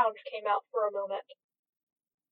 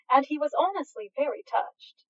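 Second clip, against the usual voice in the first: usual speaking voice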